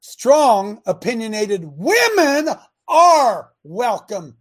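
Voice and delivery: slow, loud declaration; loud slow emphasis. Real, not acted. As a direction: lean hard on every word. Preacher stressing his point.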